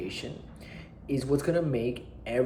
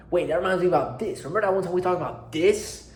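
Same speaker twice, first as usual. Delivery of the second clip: higher, faster, eager rush of memories; imitating a buddy excitedly interrupting, higher and quicker; excited friend; 7 semitones higher, 5 dB louder than usual. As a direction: blurt it out like a friend who just remembered. Friend excitedly jumping between topics.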